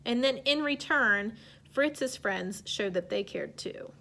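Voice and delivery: normal talking voice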